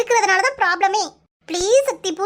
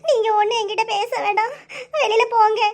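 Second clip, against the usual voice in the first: tearful, voice catching between sobs; crying; 3 semitones higher than usual. Acted. Girl sobbing at her lover to leave. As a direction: push him away through tears. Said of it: "அழாதடி".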